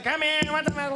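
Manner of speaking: put-on high-pitched old-movie leading man; 1930s guy's voice. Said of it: "old nineteen thirty guy's voice".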